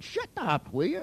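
high-pitched, retold "shut up"; mock exasperation. Therapist cutting off a man describing pain, mock exasperated. Snapping at a groaning patient.

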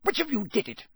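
high, sharp demand; snapping. A narrator's reading. Crouch interrogates the children in rage, snapping. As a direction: snap an accusation. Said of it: "he snapped".

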